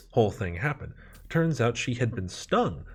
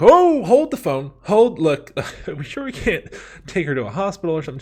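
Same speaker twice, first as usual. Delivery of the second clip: high, loud mock-outraged outburst; shocked protest; 8 semitones higher, 11 dB louder than usual. Teen balking at mom's request, loud shocked protest.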